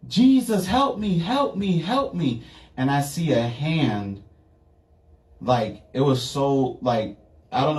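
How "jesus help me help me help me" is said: high, desperate cry for help; frantic high pleading; retold screaming prayer. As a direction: cry out to Jesus, pitch soaring. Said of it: "I'm yelling and I'm screaming".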